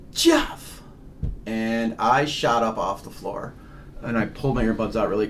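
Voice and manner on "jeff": sudden high-pitched call of a name; imitated yell. Recounting a name yelled into the earbuds, imitated yell. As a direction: mimic a startling yell, leaping up high. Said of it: "my name got yelled into the earbuds".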